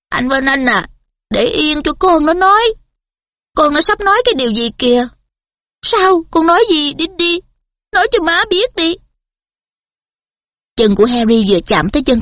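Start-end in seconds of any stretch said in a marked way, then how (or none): 0.1-9.0 high, eager pleading; anxious coaxing mother. A narrator's reading. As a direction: coax the boy to speak, high. Mother coaxing her son.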